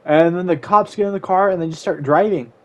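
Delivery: normal talking voice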